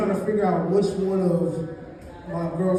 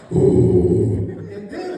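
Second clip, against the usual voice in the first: big roaring mock snore; loud snore imitation; 7 dB louder than usual. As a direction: blast out a huge snore like a sumo wrestler. Snoring noise imitation.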